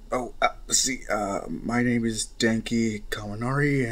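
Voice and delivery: ordinary talk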